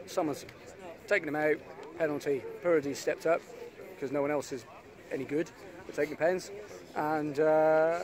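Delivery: half time report. Acted.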